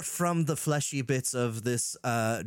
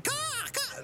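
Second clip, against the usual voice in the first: much higher squawking caw; seagull caw; 4 dB quieter than usual. A narrator's reading. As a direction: squawk like a gull. Narrator imitates seagulls.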